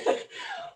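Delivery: high laugh. Real, not acted. Coach laughs after tongue slip.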